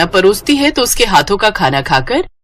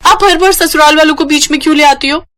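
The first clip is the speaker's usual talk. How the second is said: higher, whiny complaint; indignant protest; 7 semitones higher than usual. Acted. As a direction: push back at mom. Daughter protesting to mother.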